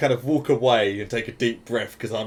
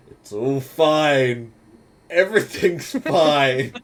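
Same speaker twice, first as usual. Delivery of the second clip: slow, nervous laughter, louder and higher; forced calm; 4 semitones higher, 6 dB louder, much slower than usual. Pretend. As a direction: pretend all is fine while laughing with panic. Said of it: "And I'm just there going".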